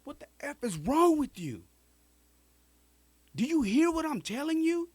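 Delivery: high, loud furious shout; angry yell. Retold or imitated. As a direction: yell it right in his face. Cousin yells at him.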